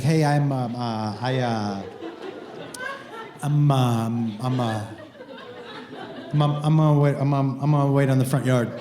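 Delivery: low, halting voice tripping over every word; nervous stutter. Retold or imitated. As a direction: stammer it out like a nervous young tutor. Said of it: "he starts to stutter a little bit".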